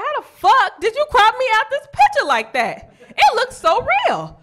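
high, loud incredulous outburst; mock outrage. Discovering she was cropped out of the photo, high loud outrage. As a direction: shout it high in disbelief.

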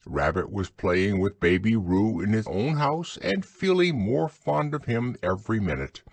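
ordinary delivery

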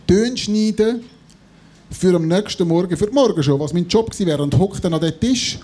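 usual speaking voice

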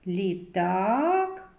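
"Літак" with a long, rising "а"; second vowel stretched. Shown as a demonstration. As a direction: hold the A in "Літак". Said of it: "Звуковий аналіз слова".

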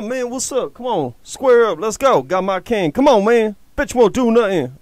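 ordinary talk, nothing marked

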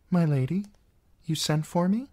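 put-on a polite, uneasy young girl; a girl answering her aunt; young Sansa. Sansa voiced by the narrator.

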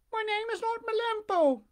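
put-on high, squeaky wooden-boy voice; high puppet voice. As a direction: pipe it up like a puppet.

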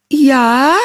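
high, questioning and tentative; cautious answer. Acted. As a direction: answer warily, voice lifting. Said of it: "eine vorsichtige Antwort".

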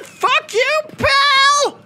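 top-of-the-range squealed yell; mock furious retort. Player topping the game's insult, squealed mock fury. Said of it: "And I'm like".

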